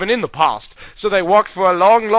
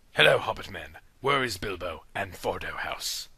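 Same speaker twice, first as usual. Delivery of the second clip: low, soft voice; 10 semitones lower, 12 dB quieter than usual. Acted. Hobbits addressed.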